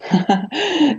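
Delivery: a quick soft laugh before answering; short laugh. Real, not acted. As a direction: laugh lightly, a bit embarrassed. (kurzes leises Lachen)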